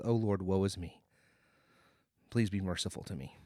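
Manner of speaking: low, subdued plea for mercy; humble pleading. Retold or imitated. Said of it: "the attitude of the tax collector".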